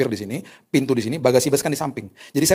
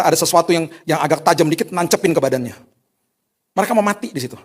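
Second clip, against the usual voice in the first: louder, urgent preaching; intense preaching; 3 semitones higher, 5 dB louder than usual. Acted. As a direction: paint the darkness for them. Preaching about chemo ward darkness.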